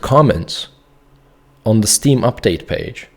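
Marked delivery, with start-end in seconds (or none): none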